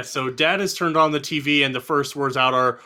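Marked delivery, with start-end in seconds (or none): none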